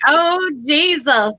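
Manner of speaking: mock swooning. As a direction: swoon over an irresistible accent. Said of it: "but I'm like".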